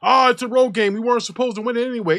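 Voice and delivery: lightly mocked fan excuse, fast; mocking coworker. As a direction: brush it off like a fan in denial. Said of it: "getting text already from guys from work".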